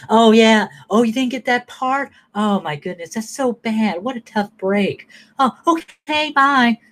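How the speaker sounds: high, syrupy mock concern; fake sympathy. Mimicking fake pity toward Ron over the lost part, high and sugary.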